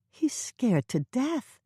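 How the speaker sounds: mild fear for her pet; alarmed. A narrator's reading. Hannah telling Norman about the cat, alarmed.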